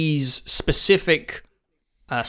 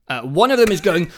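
normal talking voice

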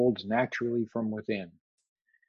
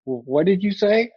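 acted disbelief; 8 semitones higher, 4 dB louder than usual. Man doubting the voice's instruction.

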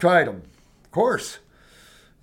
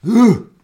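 loud, disgusted grunt of revulsion; sick of mucus-forming foods, loud gagging ugh; 6 semitones higher, 11 dB louder than usual. Real, not acted. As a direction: spit out a loud ugh like you smell spoiled milk.

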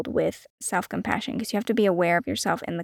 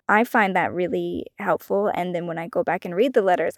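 ordinary talk, nothing marked

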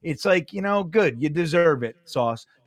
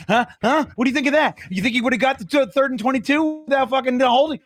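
higher, louder trash talk in a rival's face; pretend taunting; 7 semitones higher, 3 dB louder, faster than usual. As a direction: needle him after every play. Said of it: "like the Jermaine Johnson play".

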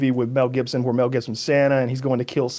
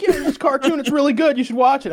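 high-pitched pushy imitation of a recommender; high mocking retold pitch; mock sales pitch; 11 semitones higher than usual. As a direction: mimic someone pitching a show, pitched way up.

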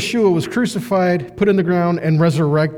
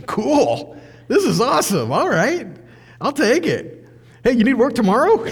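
gleeful worker; 6 semitones higher than usual. Retold or imitated. Voicing the happy late worker.

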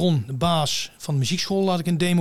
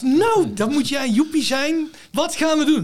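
veel hoger en luider, overdreven opgewonden lerares nadoen; hyper enthousiast; 12 semitones higher, 5 dB louder, slower than usual. Retold or imitated. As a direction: een uitgelaten zangjuf imiteren, hoog en luid. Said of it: "hyper enthousiast". (zanglerares Irma die hyper enthousiast de leerling begroet, veel hoger en luider)

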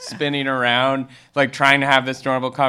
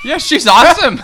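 high, loud laugh-shout; 11 dB louder than usual. Real, not acted. Host agreeing, laughing and shouting.

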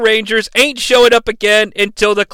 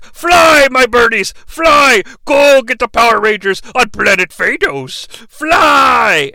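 loud, high villain cry; rally the birds with a booming command; villain commanding shout; 3 semitones higher, 4 dB louder than usual. Acted. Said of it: "he's like".

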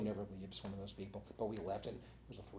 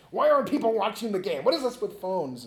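higher and louder, imitating the manager griping about phones; mock exasperated rant; 7 semitones higher, 9 dB louder than usual. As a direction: play the fed-up manager complaining, loud and pitched up. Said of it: "and Collins is like".

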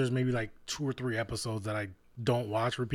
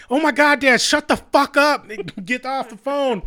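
much higher and louder, a slowed furious snap; snapping at dad; 11 semitones higher, 8 dB louder than usual. Retold or imitated. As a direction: lose it at Dad and bark him off the phone. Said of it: "and then Morty snaps".

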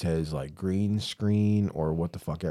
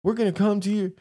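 much higher mocking voice for the threat; 11 semitones higher than usual. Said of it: "And it was like".

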